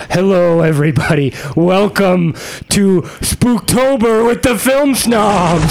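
slow, drawn-out spooky welcome; spooky host voice. Acted. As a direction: stretch it out like a horror host. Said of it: "after I say welcome to Film Snob Spooktober".

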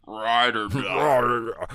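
slow, mushy drawn-out words; mouth full of peanut butter. Pretend. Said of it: "You sound like you have a mouthful of peanut butter".

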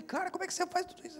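high, fast, amazed exclamation; astonished. Retold or imitated. Preacher amazed at the man's new car stereo, high and fast.

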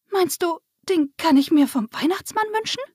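put-on high, wide-eyed child question; little girl. A narrator's reading. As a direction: ask in hopeful wonder, pitched high. Lisa's wide-eyed question in story. (staunende, hohe Mädchenstimme)

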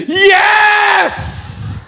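very high, loud, held shout; long falsetto yell of triumph; ecstatic cry. Acted. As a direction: scream it out in hammed-up rapture. His ecstatic reaction to the woman.

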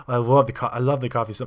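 gushing mock Polish accent; excited Polish accent. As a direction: imitate an eager tourist with a Polish accent. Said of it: "I'm pretty sure it was Polish accent".

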